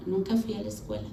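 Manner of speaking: subdued confession. Retold or imitated. Wife admitting she never went to school, subdued. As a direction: own up quietly, pitch dropping.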